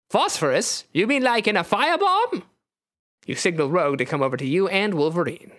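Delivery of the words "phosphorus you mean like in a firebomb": put-on high, alarmed accented question; rising high-pitched character voice; Nightcrawler. Nightcrawler questions Wolverine about firebomb, high and accented.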